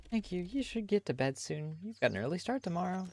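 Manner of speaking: a put-on mother worrying about the aunt's visit; parent voice. Same parent figure continues.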